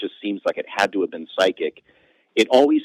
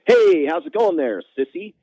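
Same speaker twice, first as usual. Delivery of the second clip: a goofy high "sissy"; blurted greeting; 10 semitones higher than usual. Retold or imitated. Comic storyteller blurting to a new coworker. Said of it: "and I'm like".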